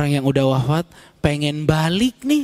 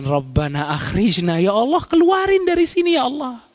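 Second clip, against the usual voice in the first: pleading in unison; 7 semitones higher than usual. Acted. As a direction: beg God to get them out. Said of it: "mereka serentak mengatakan".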